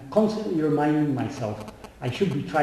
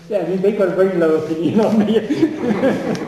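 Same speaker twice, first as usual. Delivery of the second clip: chuckling through the words, a bit louder; laughing; 3 semitones higher, 4 dB louder than usual. Real, not acted. A seeker laughing that his family thinks little of him, laughing. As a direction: laugh it off, amused at himself.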